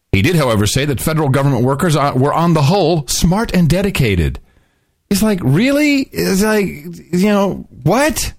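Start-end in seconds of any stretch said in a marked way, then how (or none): 5.1-8.3 high-pitched incredulous "really?"; can't-believe-it reaction; mock disbelief. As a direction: act baffled, pitch leaping up on the questions. Mock disbelief at the president's claim.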